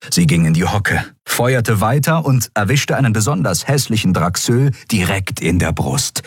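usual speaking voice

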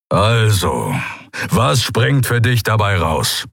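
slow, growling brute; rumbling Keru. Acted. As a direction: menace him slowly. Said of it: "grollte Keru".

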